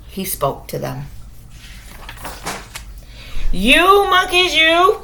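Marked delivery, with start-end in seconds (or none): 3.5-5.0 raised voice, scolding the monkeys; peddler scolding monkeys. Acted. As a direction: wag a finger and scold. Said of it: "he said".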